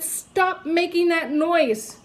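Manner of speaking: slow, firm scold; stern principal. A narrator's reading. Principal scolds the teacher, slow and firm. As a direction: lay down the law slowly.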